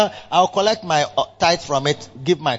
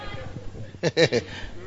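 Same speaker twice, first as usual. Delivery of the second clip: soft laugh; 5 semitones lower, 7 dB quieter than usual. Real, not acted. Laugh alone on line.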